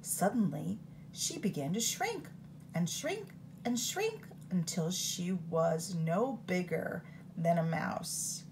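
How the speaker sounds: usual speaking voice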